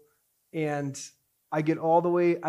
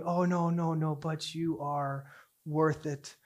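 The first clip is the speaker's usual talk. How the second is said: tender reassurance; 5 dB quieter, slower than usual. Retold or imitated. God telling someone they are worth it, tender and soft. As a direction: reassure gently, letting the last words slow.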